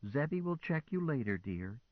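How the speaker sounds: calm a frightened friend; soothing. A narrator's reading. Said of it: "Hilda said soothingly".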